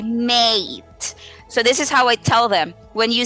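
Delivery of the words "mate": "mate" with the long A stretched; correct long A in mate. Shown as a demonstration. Teacher corrects learners, long A held in mate. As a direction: draw out the A in "mate". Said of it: "Long A, it should be".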